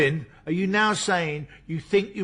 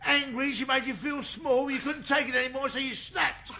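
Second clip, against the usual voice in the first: pressing hard; 9 semitones higher than usual. Acted. Detective pressing suspect, high pitched.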